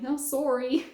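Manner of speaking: "sorry" imitated as Canadian "SORE-ee"; Canadian accent. Fondly mimicking her Canadian boss, "sorry" in Canadian accent. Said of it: "I love the way he says sorry".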